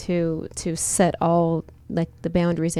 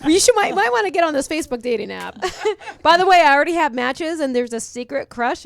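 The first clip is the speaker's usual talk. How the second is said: laughing; 7 semitones higher, 4 dB louder than usual. Real, not acted. Giggling about dating app.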